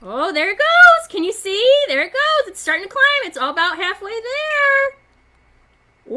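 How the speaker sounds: high, delighted cheering as the water climbs; excited. Real, not acted. Teacher showing kids the water climbing, excitedly.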